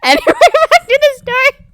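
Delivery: loud, very high squeal through laughter; helpless high-pitched laughing speech; laughing uncontrollably. Real, not acted. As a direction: force the words out while cracking up. Trying to resume while cracking up.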